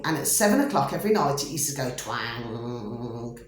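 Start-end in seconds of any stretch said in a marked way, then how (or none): 2.0-3.4 low, slow, drawn-out twang; imitated toy twang. Telling listeners about the toy in the loft, a low slow twang. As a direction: sound out the toy's spring. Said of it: "like that".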